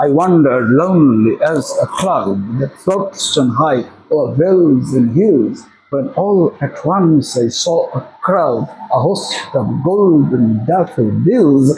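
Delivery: low, slow, measured verse reading; poem recitation. Shown as a demonstration. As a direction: recite it as poetry, drawing out the lines. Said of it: "কবিতা, কবিতা করে বলবে". (কবিতার মতো ধীরে, নিচু সুরে আবৃত্তি)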